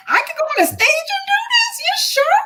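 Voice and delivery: very high, squeaky disbelief; teen amazed she could do stand-up; incredulous. Retold or imitated. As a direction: squeak it like it's too good to be true. Disbelief at stage idea.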